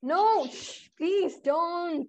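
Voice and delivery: high, loud begging; pleading protest. Real, not acted. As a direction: beg him to stop. Host pleads with co-host.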